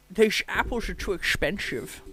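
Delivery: slow, slushy Scottish star voice; slurred sh-sounds, drawn out slowly; Sean Connery. Acted. Comic asked for accents, doing a famous actor's voice. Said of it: "Sean Connery".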